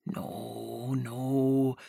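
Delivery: low, drawn-out sly no; a sinister man taking his time; slow refusal. Acted. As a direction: deny it slowly, savouring it. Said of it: "he said slowly".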